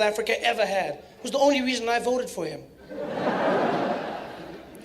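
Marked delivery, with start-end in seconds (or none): 2.9-4.8 breathy laugh. Real, not acted. Mock delight.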